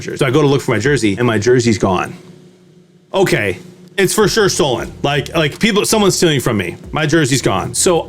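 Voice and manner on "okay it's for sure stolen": usual speaking voice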